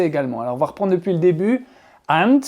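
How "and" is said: British-accented AND, said loud. Shown as a demonstration. Teacher modelling linking words for pupils, British accent. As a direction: say a clear British "And". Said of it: "avec mon accent britannique".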